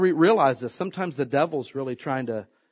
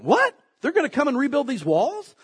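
mock shocked enemy; 9 semitones higher than usual. Enemies hearing of the rebuilt walls, shocked. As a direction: act the enemies' alarm.

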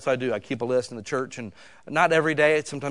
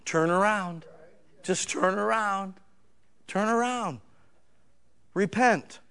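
pleading, slow call to turn around; higher voice urging repentance; father calling out; 4 semitones higher, slower than usual. Retold or imitated. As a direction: plead as a father calling his child home. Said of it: "your father's shouting out".

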